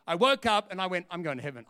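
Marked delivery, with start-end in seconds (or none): 1.1-1.6 low, soft aside to oneself; hushed realisation. Retold or imitated. Preacher reliving the morning after his prayer, hushed. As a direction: drop down low and soft in wonder.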